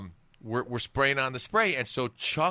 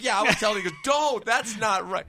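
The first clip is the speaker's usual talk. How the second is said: mock protest; 11 semitones higher, 5 dB louder, faster than usual. Mock self-defense in story.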